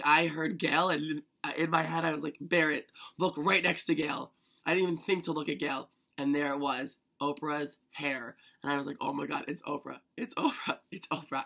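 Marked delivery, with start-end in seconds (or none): 2.4-4.3 louder, urgent retelling of a thought; mock-frantic order to yourself; urgent self-command. Telling herself to look beside Gail, urgent. 9.0-11.4 starstruck repetition with a jump in pitch midway; retold freak-out. Fan spotting Oprah in the theater, freaking out. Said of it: "And I freaked out".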